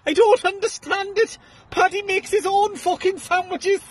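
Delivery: very high, slow falsetto widow; Irish wife. Retold or imitated. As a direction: deliver the punchline as a squeaky Irish wife, slowly. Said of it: "the Irish woman says".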